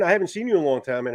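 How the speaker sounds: ordinary talk, nothing marked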